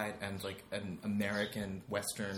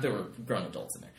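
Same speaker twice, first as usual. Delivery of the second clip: ordinary delivery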